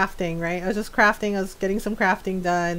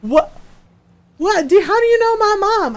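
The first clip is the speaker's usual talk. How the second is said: confused squeal; 12 semitones higher, 6 dB louder than usual. Retold or imitated. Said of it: "And he was just like".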